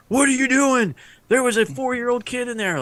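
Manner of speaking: high, scolding yell in disbelief. Retold or imitated. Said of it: "there's somebody yelling at you".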